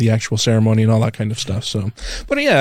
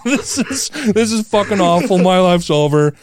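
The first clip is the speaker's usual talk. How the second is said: imitating his own panic through a laugh, pitched higher; laughing mock despair; 6 semitones higher than usual. Groom recalls waiting on her reply, laughing mock despair. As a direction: laugh out the end of the world, half joking. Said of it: "and I'm like".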